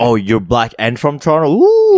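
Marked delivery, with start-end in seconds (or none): 1.5-2.0 high, loud "ooh"; a big high-pitched ooh of dread; wincing ooh. Real, not acted. Reacting to a bad situation.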